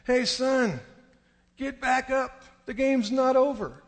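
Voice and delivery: high, loud imitation of Dad calling. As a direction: shout it across the field to your son. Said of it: "I heard my dad's voice say".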